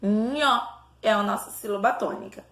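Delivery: ordinary delivery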